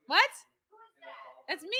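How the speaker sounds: normal talking voice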